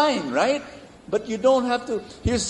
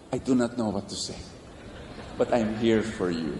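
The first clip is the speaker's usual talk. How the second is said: soft, lower words of comfort; gentle comfort; 7 semitones lower, 5 dB quieter than usual. Shown as a demonstration. Pastor demonstrates comforting a grieving person, softly. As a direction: offer it quietly, like a hand on a shoulder. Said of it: "just say".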